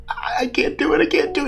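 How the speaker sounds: small, very high panicky voice; imitated scared whimper. Scared diver sitting on the high edge, high mock whimper. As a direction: squeak it out softly as if frozen with fear. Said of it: "just sitting there, just going".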